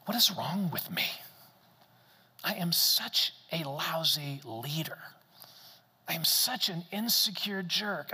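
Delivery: a hint of berating himself under his breath; self-condemning inner voice. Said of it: "shame started to kick in".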